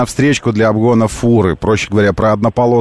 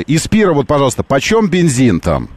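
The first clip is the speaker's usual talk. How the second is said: ordinary delivery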